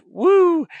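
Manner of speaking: high, loud, drawn-out "woo"; playful woo. Pretend. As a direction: sing out a long whooshing "woo". Imitates values dropping away.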